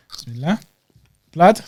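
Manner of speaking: normal talking voice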